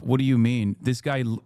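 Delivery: ordinary talk, nothing marked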